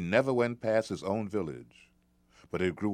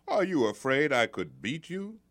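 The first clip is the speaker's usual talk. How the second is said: put-on sly taunting boy; 7 semitones higher than usual.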